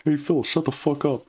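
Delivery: mock tough-guy line through the nose; lightly nasal John Rambo. Shown as a demonstration. Telling Phil to shut up, in a lighter nasal Rambo voice. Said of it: "let's see how this works out".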